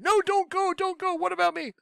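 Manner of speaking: man parodying what he didn't say, high whine; mock pleading. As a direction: ham up a needy begging voice. Mocking a clingy plea.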